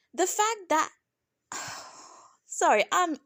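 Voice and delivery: real flustered stumbling, with a sigh. Real, not acted. Reviewer loses her thread ranting about the fight, flustered. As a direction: lose the thread, sigh and restart. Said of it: "I'm getting flustered here".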